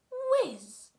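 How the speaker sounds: high loud whooshing whiz; a sharp whistling whiz; cannonball whiz. Acted. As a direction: make the cannonball zip past. Cannonball flying past.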